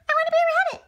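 put-on high, whiny kid frog; little frog child. Son frog whines to Daddy in a high child voice. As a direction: pipe up like a small frog child.

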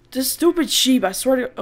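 frustrated rant; annoyed outburst. Real, not acted. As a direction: vent at the stupid sheep.

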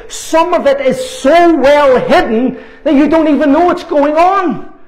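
full-force sermon delivery; fiery preaching. Acted. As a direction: preach it hard, warning the congregation. Preaching against demons in church.

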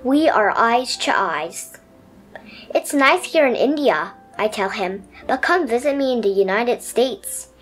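put-on gentle read-aloud for children; lively storybook narration for kids. A narrator's reading. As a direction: tell it like a bedtime picture book. Reads picture book aloud.

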